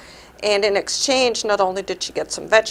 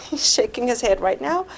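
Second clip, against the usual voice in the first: ordinary talk, nothing marked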